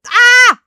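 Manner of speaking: high, loud startled yelp; short real scream; sudden scream. Real, not acted. Scream at the game reveal.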